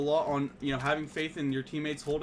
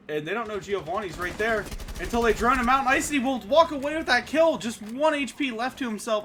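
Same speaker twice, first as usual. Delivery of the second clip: rising, louder shoutcast call; excited play-by-play; 5 semitones higher, 5 dB louder than usual. Real, not acted. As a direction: call the kill with a jolt of hype. Caster reacts to a kill.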